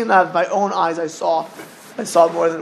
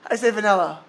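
ordinary talk

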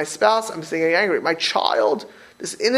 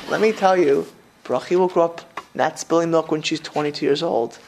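ordinary talk

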